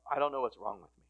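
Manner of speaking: ordinary talk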